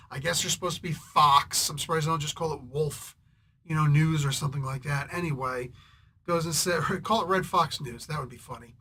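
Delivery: ordinary talk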